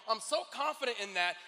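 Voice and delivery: ordinary delivery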